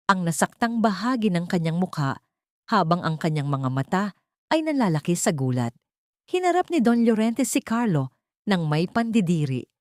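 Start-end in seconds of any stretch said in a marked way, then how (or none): none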